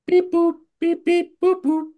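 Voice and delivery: high beeping dial tones, voiced; alien voice. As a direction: chirp beep boop like a phone keypad. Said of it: "I'm gonna call him up here".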